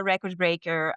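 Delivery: normal talking voice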